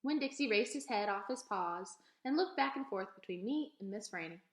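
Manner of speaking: ordinary delivery, nothing marked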